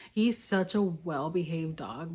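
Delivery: ordinary talk, nothing marked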